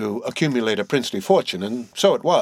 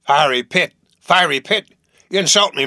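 loud, higher furious outburst; thundering; 5 semitones higher, 5 dB louder than usual. A narrator's reading. Captain Peleg thunders at Bildad over hell, furious shout. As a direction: explode at the insult, shouting. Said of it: "As he thundered out this".

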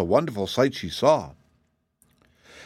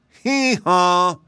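high, loud hee-haw; two-note bray held high; mule bray; 9 semitones higher, 5 dB louder than usual. A narrator's reading. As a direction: answer with a donkey's bray. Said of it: "at his bray".

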